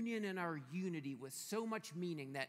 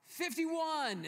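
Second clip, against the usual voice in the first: high, loud inmate calling out a number; joke-telling shout, pitched up; acted yell; 10 semitones higher, 6 dB louder than usual. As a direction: holler it down the cell block. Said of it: "another guy yells out".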